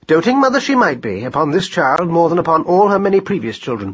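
usual speaking voice

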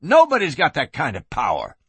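high, loud indignant protest; outraged. A narrator's reading. Gunman protests being barred from town, outraged. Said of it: "Flynn was outraged".

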